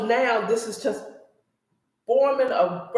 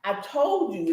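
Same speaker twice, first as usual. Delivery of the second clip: ordinary talk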